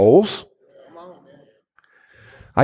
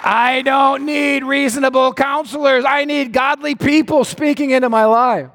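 impassioned declaration; 10 semitones higher than usual. Real, not acted. Preacher rejecting reasonable counselors, impassioned cry. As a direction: proclaim it with full conviction. Emphatic preaching after the shout.

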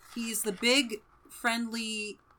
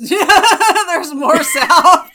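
loud laughing echo, much higher and slow; 9 semitones higher, 18 dB louder, much slower than usual. Real, not acted. Co-host laughing at the north-south mix-up, cracking up. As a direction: repeat it while cracking up.

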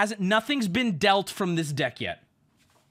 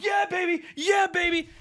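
high, hyped-up victory cheer; re-enacted triumphant yell; gloating celebration; 11 semitones higher than usual. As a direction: crow it high, twice over. Acting out his pointing celebration.

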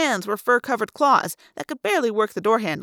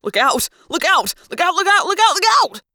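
urgent yell, climbing higher; panicked yelling that builds; yelled warning; 8 semitones higher, 5 dB louder than usual. A narrator's reading. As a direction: shout a frantic warning, rising in pitch with each repeat. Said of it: "Jake yelled".

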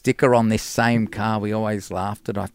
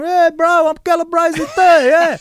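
much higher, louder, shouted call from a car; 9 dB louder than usual. Retold or imitated. Passing drivers hailing the bass player, with a loud mock shout. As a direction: yell a hammed-up greeting across traffic. Strangers tooting and calling from cars.

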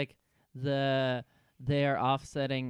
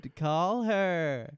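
hammed-up urging; 6 semitones higher, 4 dB louder, much slower than usual. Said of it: "echoing Saeed impression".

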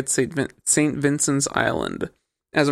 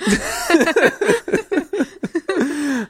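long, high-pitched giggling fit; drawn-out giggle at oneself; high laugh. Real, not acted. Laughs after admitting he looked it up.

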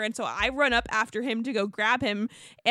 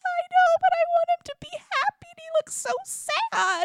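whiny self-mock; 5 dB louder than usual. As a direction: squeak it out like a fretful worrier, dragging it. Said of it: "I'm like".

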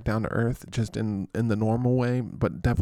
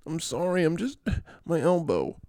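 higher, pitiful mock whine; Saquon; 6 semitones higher than usual. Injured player apologizing to coach, whimpering mock voice. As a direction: apologize haltingly as a hurt player.

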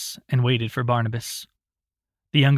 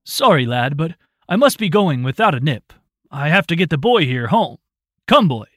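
put-on brisk, louder old man rushing out; hurried loud Roland; 3 semitones higher, 6 dB louder than usual. A narrator's reading. Roland to Barnabas as they rush off, loud and hurried. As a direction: bustle the boy out the door, calling louder.

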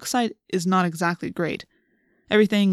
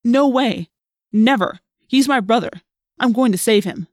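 louder, higher, emphatic defiance; heated refusal; 4 semitones higher, 6 dB louder than usual. A narrator's reading. Jake refuses to leave his brother, heated and defiant. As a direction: refuse forcefully, each phrase punched.

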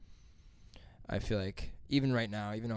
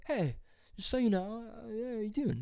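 high, mock-casual check-in; awkward greeting; 11 semitones higher, 3 dB quieter than usual. Son checking in with his parents, high awkward voice. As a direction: put on a sheepish high voice, feigning ease.

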